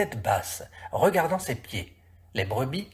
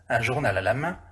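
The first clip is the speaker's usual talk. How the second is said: ordinary delivery, nothing marked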